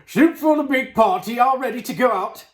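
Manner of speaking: high, loud cook's call; shouted announcement. A narrator's reading. Cook announces soup for the big party, shouted. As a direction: call it out loudly across a kitchen. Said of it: "He put the lid on the basin and shouted".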